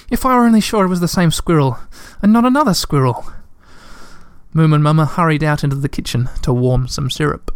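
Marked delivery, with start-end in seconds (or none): 0.1-3.3 shivering, teeth chattering. A narrator's reading. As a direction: speak through chattering teeth. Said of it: "between chattering teeth".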